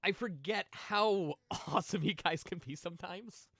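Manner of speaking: choked up. Real, not acted. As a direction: let your voice catch as you thank them. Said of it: "I don't mean to get emotional".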